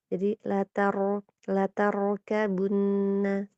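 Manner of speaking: "latarkabunna" slow, trilled ro; Arabic ro not English R. Shown as a demonstration. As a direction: roll the ro, slowly. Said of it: "ro-nya kayak R bahasa Inggris".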